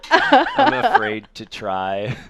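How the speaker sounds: slow, laughing through it; words squeezed out between laughs. Real, not acted. Laughing at co-host's joke.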